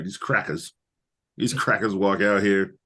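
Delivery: ordinary talk, nothing marked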